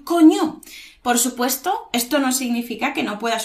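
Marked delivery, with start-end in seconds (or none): 0.0-0.6 high, loud "coño"; reacting to cold. Shown as a demonstration. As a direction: exclaim at the biting cold. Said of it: "¿Hace frío?".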